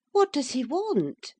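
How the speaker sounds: put-on high, eager young woman's voice; Catherine, high voice. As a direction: voice a lively young lady, high. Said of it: "asked Missus Linton".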